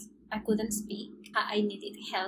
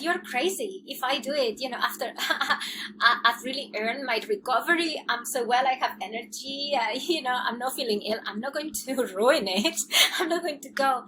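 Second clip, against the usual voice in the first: louder, giggling disbelief; amused retold refusal; laughing protest; 3 semitones higher, 6 dB louder than usual. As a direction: laugh it off as crazy.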